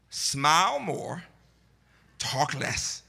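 slow, spaced-out advice, dropping low at the end; measured quoted advice. Retold or imitated. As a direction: deliver it slowly, pause, then drop down. Quoting Burr's advice after a pause.

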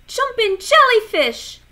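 high, loud exclamation; exclaiming loudly. A narrator's reading. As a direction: cry it out, startled. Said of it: "he groaned".

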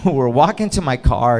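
ordinary talk, nothing marked

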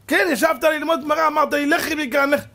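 high, insistent complaint; hurt wife throwing his words back; acted indignant nagging; accusing wife. Acted wife accuses her husband.

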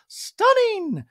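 high, loud mock squeal; hammed-up gushing impression; Dorit. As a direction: belt the compliment in a squeaky put-on voice. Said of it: "And Dorit to Garcelle goes".

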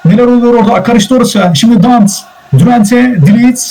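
high-pitched, urgent match commentary; excited play-by-play. Real, not acted. Commentator calling the attack and goal for viewers, excited.

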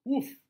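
loud high woof; single bark. Acted. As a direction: bark once like the dog. Dog jumps on bed.

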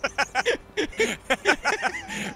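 shrieking laugh breaking out; high burst of laughter. Real, not acted. Laughing at the fat bastard joke, a high shriek of laughter.

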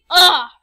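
loud, exasperated "ugh"; frustrated grunt. Real, not acted. Vlogger unable to think what to say, loud frustrated groan.

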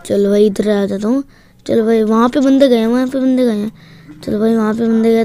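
ordinary delivery

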